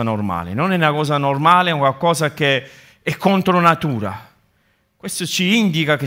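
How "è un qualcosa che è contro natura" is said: ordinary delivery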